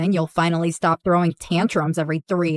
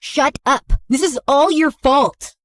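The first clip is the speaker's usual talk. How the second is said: bratty; 5 semitones higher than usual. Acted. Sulky goth teen snaps.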